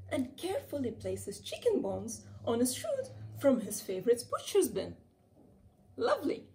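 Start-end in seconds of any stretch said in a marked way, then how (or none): 6.0-6.5 high, pleased cry; smug delighted cat; delighted exclamation. A narrator's reading. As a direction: gush over the smell.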